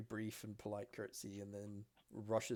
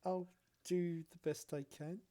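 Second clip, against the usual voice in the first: higher, polite promise; light elf girl reply; 7 semitones higher than usual. Acted. The elf girl promises Silas only her best, higher. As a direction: answer brightly, promising nothing.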